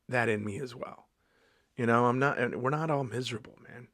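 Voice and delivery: ordinary talk, nothing marked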